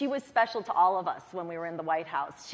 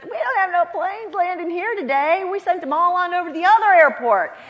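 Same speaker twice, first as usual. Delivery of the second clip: much higher, louder blunt brush-off; tower man's brush-off; 12 semitones higher, 9 dB louder than usual. Retold or imitated. Tower man telling advance staffer the planes went elsewhere, blunt and loud. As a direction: toss off the bad news like a tower guard. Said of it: "He said".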